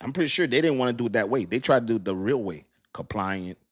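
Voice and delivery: usual speaking voice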